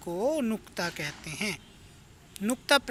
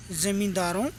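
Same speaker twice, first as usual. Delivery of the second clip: usual speaking voice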